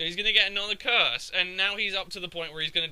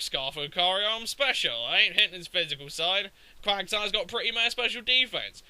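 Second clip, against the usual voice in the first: normal talking voice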